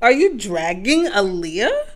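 slow, loud, offended challenge; mock outrage. Real, not acted. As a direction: drag it out like she's been insulted. Defending [name] in debate.

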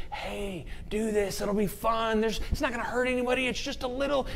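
high, soft, coaxing tempter; voice of sin. Acted. Your sin talks you into it, high wheedling voice. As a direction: coax lightly, rushing past any objection. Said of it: "Your sin goes".

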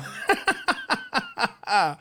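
bursts out in a high laugh; can't hold back a real high laugh; high burst of laughter. Real, not acted. Host laughing at the Patriots trade news: high laughter.

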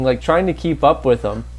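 ordinary talk

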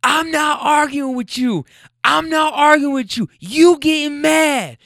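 high, loud, slow mock yell at her man; retelling her shouting back; imitating her yelling. Woman yelling at her boyfriend outside the car, high shout. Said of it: "And she's going".

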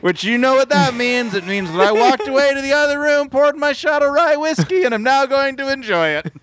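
high, loud mock announcer. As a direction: hype it up like a show host. Riverdale reference alarm bit.

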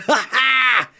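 loud, high hype-man ad-lib cackle; big high-pitched mock laugh. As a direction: whoop it like a rapper's hype man. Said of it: "being like a hype man rapper".

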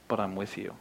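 a soft, low promise, nearly a whisper; tender hush. Acted. Jesus quietly reassures his fearful disciples, tender hush.

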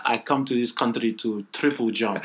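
loud put-on African accent. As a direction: declare it in a joking African accent. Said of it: "African accent".

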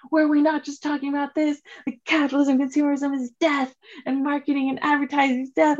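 high-pitched, heated imitation of an outraged counselor; mind-blown rant. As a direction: rant as if your head just exploded. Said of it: "his head, like, explodes".

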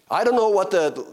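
ordinary delivery

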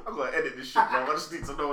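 normal talking voice

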